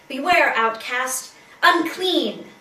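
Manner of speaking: loud, dramatic proclamation; warning cry. Acted. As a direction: call it out forcefully, like an outcast's warning. Acted cry of the outcast.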